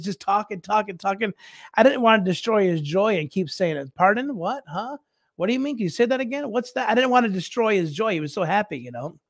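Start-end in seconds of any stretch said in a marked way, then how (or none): 3.9-6.9 higher-pitched, baffled questions; puzzled asking. Acted. Listener who can't understand a Cockney man, puzzled and higher. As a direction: keep asking as if you missed it. Acted repeated requests to repeat.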